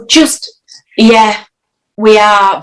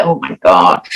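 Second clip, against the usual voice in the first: mock eye-roll; 13 semitones lower than usual. Mocking herself as a listener.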